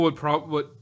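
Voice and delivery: usual speaking voice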